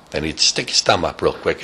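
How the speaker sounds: ordinary delivery, nothing marked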